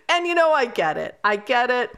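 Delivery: ordinary delivery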